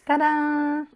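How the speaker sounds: high, held-out reveal fanfare; triumphant ta-da. Real, not acted. Crafter revealing the peeled film to viewers, triumphant.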